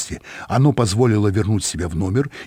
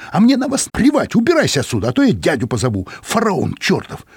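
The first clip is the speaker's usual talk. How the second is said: high, louder outburst; indignant; 9 semitones higher, 3 dB louder than usual. A narrator's reading. Angry girl ordering the detective out, indignant. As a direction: throw him out, furious and high. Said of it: "возмутилась Чада".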